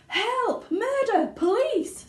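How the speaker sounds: very high, loud panicked scream; screaming mother. A narrator's reading. Mother screaming as her son shoots up the pipe. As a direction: shriek for the police. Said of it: "screamed Missus Gloop".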